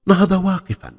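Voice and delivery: ordinary delivery, nothing marked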